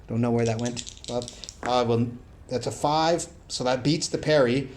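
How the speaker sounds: ordinary delivery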